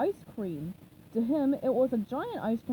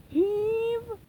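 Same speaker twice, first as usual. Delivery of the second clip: higher, a bit louder heave call; shouted; 10 semitones higher, 4 dB louder than usual. A narrator's reading. Mister Krabs calls the push, shouted heave. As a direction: call the heave like a work chant. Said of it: "shouted Mister Krabs".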